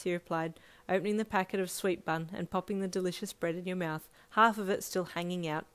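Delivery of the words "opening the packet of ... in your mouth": plain reading voice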